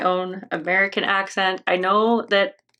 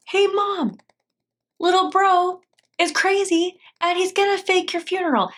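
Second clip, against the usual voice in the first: acted tattling; 8 semitones higher than usual. Older brother tells Mom about little brother's plan, acted high-pitched tattling. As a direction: tell on your brother in a raised put-on voice.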